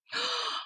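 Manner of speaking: soft high startled gasp; a quick breathy intake of shock; short hushed gasp of surprise; sharp gasp. Real, not acted. Gasp at ghost asking on phone.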